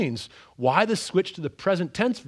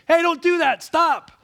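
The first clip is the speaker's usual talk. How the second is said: much higher, loud mock yell; yelling at the screen; 13 semitones higher, 8 dB louder than usual. Viewer yelling warnings at the screen, loud mock yell. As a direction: shout a warning at characters who can't hear. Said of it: "yelling at the screen".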